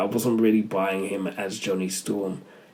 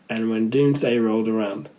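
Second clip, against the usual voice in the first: normal talking voice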